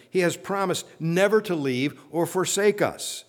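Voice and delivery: rising sermon fervor; fervent preaching. Acted. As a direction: lift the room with each promise. Preaching at full force.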